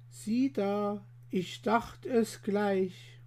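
soft, slow and gentle; mild and soft. Acted. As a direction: say it mildly, like a kind widow. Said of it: "mild und weich".

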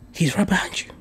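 hushed whispered warning. Retold or imitated. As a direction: whisper it like a secret tip-off. Girl voiced warning him.

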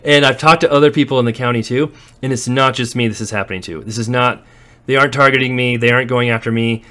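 usual speaking voice

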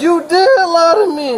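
yelling through tears; crying shout. Real, not acted. Man accusing the officer.

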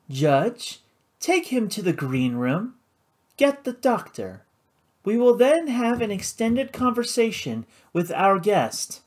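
high, cold and clipped orders; commanding hostess. Acted. Karina to the judge, cold command. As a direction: give orders without doubt.

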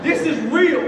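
loud, high emphatic insistence. Real, not acted. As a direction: drive home that it was real. Stressing the hardship to audience.

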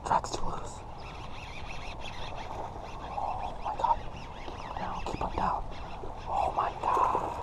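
hushed, awestruck whispering; excited whisper. Real, not acted. Angler to viewers with a fish on, excited whisper. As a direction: whisper it urgently, keeping the excitement down.

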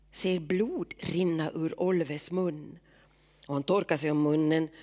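ordinary delivery

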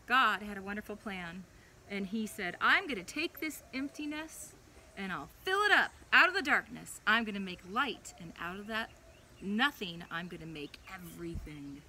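put-on gentle storybook read-aloud for a child; animated children's Bible story voice. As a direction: tell it like a bedtime story.

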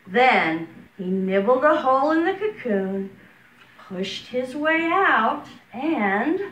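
slow, lilting storybook voice; reading to children. Acted. Preschool teacher reading a picture book to her class, lilting. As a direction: read it like circle time, slow and sing-song.

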